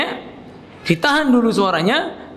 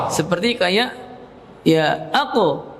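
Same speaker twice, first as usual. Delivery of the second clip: usual speaking voice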